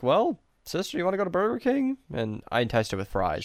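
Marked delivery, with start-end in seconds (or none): 0.0-2.0 high, quick, coaxing sing-song. Retold or imitated. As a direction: tempt her with a sweet offer. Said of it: "I was like".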